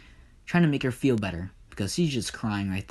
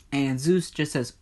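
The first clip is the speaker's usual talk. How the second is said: ordinary delivery, nothing marked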